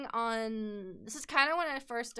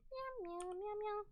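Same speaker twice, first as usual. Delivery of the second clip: cat meows; 11 semitones higher, 13 dB quieter than usual. Pretend. Playful cat noises after song.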